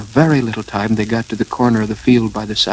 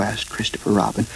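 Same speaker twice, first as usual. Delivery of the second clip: ordinary delivery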